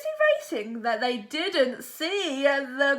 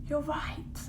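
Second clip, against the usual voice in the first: softer hushed reply; hushed agreement beside the sleeping alien; 8 dB quieter than usual. Acted. Replying to a whisper.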